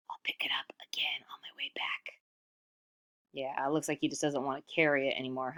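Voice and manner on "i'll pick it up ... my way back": soft breathy whispered line. Acted. Reader whispers Iktomi's sneaky plan to the children. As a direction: let the hidden thought slip out.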